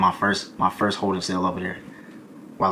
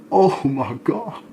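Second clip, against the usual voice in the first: drawn-out, much higher gasp of disbelief; mock dread; 6 semitones higher, 3 dB louder, much slower than usual. Retelling the jail punch to viewers, stretched high horrified disbelief. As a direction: stretch it slow and high like the world just ended. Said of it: "I said".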